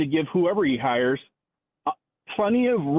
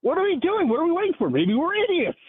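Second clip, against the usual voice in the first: mock-despairing tirade pitched much higher; sarcastic caller rant, voice climbing higher and higher; 7 semitones higher than usual.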